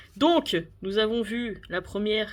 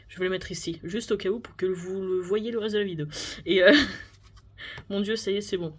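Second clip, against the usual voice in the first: normal talking voice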